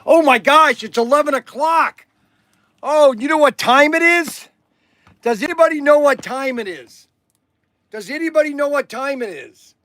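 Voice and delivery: high loud announcing; sing-song loud call to everyone; excited calling out. Real, not acted. Calling out it's donut time.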